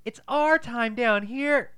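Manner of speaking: slow, high eerie quote; slow high hammed-up creepy line; slow spooky quote again. Shown as a demonstration. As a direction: stretch it out, ominous and high. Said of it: "Hold on, say it again".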